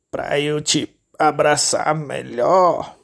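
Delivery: slow, drawn-out sly wolf voice; wolf answering with false sweetness; wolf as grandma. Acted. Lobo disfarçado. (lobo disfarçado, fala arrastada)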